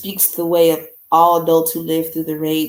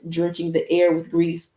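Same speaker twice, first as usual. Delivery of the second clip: usual speaking voice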